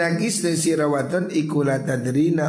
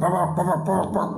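mock chatter; 5 semitones higher than usual. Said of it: "Makanya nyaut langsung".

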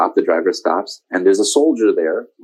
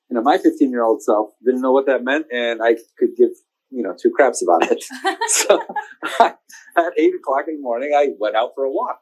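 ordinary talk

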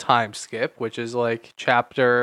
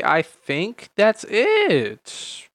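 slow drawn out; 6 semitones higher, much slower than usual. Real, not acted. Slow, stretched wrap-up.

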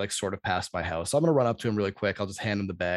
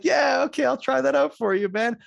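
placating; 5 dB louder than usual. Retold or imitated. Acted placating reply to pushy stranger.

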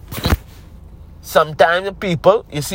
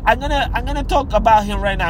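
usual speaking voice

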